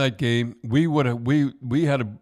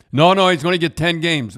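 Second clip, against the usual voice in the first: raised, louder mock-insistent no no; insistent quote; 7 semitones higher, 8 dB louder than usual. Retelling Ron McLean on air, loud and insistent. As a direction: push the no no hard and loud, sure of it. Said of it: "And he said".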